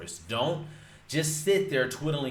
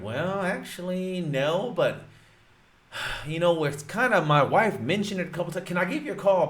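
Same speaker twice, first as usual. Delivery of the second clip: homeowner's voice; 6 semitones higher, 4 dB louder than usual. Retold or imitated. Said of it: "he's like".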